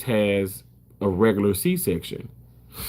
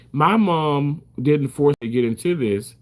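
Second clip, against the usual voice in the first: ordinary talk, nothing marked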